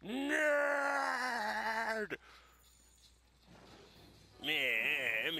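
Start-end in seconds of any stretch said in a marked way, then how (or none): 0.0-2.2 mock shout. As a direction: yell it like a bully on the playground. Taunting the monster.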